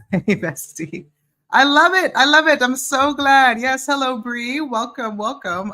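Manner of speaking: high, loud, gushing greeting; excited. Real, not acted. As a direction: squeal with delight. Greeting a viewer joyfully.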